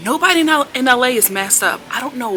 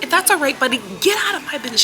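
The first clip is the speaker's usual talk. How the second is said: higher, rapid mock telling-off; pitched-up retold eviction; imitated angry dismissal; 7 semitones higher, much faster than usual. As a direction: act out a manager throwing someone out. Acted manager throwing customer out.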